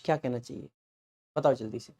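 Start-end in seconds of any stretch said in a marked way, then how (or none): none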